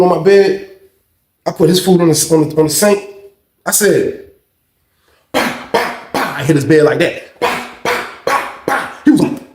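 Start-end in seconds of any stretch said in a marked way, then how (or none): 5.3-9.0 breathy bah bah knocking noise; imitated banging. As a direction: mimic slapping the bunk.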